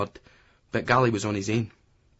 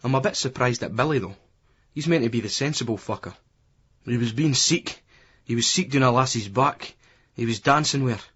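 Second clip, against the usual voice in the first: usual speaking voice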